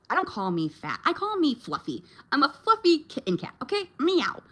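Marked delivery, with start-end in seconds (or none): 1.0-3.9 high, playful, lightly put-on kitty voice; higher, cute self-mocking purr-ish voice; cutesy kitten voice. Calling herself a fluffy kitten. 4.0-4.4 high, cute cat meow; higher, playful mock meow. Imitating a cat.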